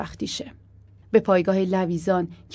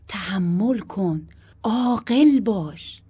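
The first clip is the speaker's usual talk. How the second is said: drawn-out, emphatic urging; slow, insistent coaxing; slow appeal; 3 semitones higher, 5 dB louder, much slower than usual. A narrator's reading. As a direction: stretch the words to plead for patience. Husband pleads for patience.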